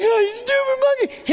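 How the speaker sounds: high mocking jeer; taunting kid. Kid taunting chimp again, high mocking jeer.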